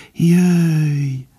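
high, long-held airy call; delicate, birdlike call. A narrator's reading. Faline calls from afar, delicate and birdlike. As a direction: call "come" high and fine, like birdsong. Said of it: "Finoman hangzott fel újra, mint a madár csicsergés".

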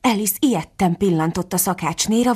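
ordinary delivery, nothing marked

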